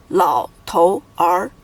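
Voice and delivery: "老头儿" slowly as three separate syllables, lao, tou, er; character by character. Shown as a demonstration. Teacher showing three characters, "老头儿" one by one. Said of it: "It is made of three characters".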